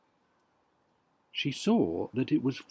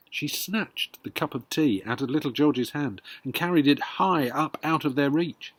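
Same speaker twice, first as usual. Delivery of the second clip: ordinary delivery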